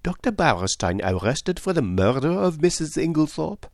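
high, incredulous question; lively astonishment. A narrator's reading. Poirot questioning news of a murder arrest, astonished. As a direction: repeat the news as if astounded. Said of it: "in apparently lively astonishment".

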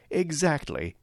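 a bright, lifted note of triumph; exulting. A narrator's reading. The manager gloats over Kurtz's unsound method, exulting. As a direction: crow it, pleased the critic proved right. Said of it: "he exulted".

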